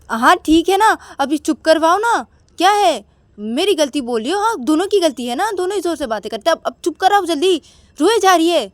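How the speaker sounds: put-on husband urges wife to calm the crying baby, loud and exasperated; exasperated shout. Akash urges hushing crying baby.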